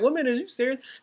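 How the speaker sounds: very high, incredulous retort; mock indignant disbelief. Husband answering wife who kicks him out, mock indignation. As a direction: challenge her in a comically high pitch. Husband acted reacting to eviction.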